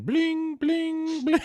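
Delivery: high ringing bling; singsong high chimes; imitated notification bling. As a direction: mimic an alert chime, high-pitched. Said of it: "people were chiming in".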